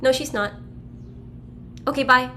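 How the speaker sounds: put-on curt, clipped child answering the phone; blunt and direct. A blunt little girl answers the doctor's caller, curt. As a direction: cut the caller off flatly. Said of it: "Just, like, really direct".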